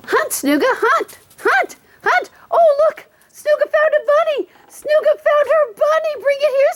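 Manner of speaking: very high, sing-song cheering for the dog; excited dog talk. Real, not acted. Owner urging her dog to hunt Easter treats, very high excited voice. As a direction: egg the dog on in a high, happy voice.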